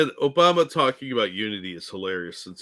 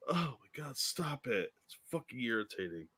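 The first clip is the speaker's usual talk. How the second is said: quiet exasperated; 14 dB quieter than usual. Real, not acted. Exasperated complaint, much softer.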